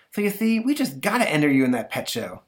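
put-on eager boy pleading; boy's voice. Stanley talking his monster into the pet show. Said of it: "He says".

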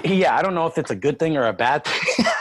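ordinary talk

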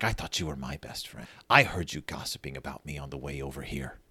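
low, soft reproach; deflated voice of a hurt friend; hurt, subdued. Retold or imitated. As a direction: answer quietly with wounded restraint. Sister's hurt reply, abruptly subdued.

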